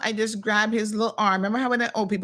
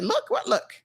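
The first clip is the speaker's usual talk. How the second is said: higher-pitched mock confusion, imitating kids; clueless kids; 12 semitones higher than usual. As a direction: play a kid who has no idea. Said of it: "They're like".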